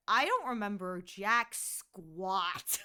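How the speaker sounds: slow it down for the punchline; deadpan own admission. Retold or imitated. Self-deprecating punchline.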